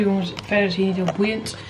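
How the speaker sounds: usual speaking voice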